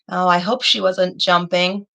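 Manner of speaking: normal talking voice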